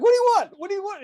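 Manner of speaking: very high, panicky demand; mock freak-out. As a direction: imitate a spooked homeowner in a high voice. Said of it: "like, freaking out".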